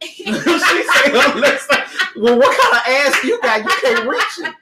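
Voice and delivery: laughing while teasing. Real, not acted. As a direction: tease her through laughter. Laughing while mocking her words.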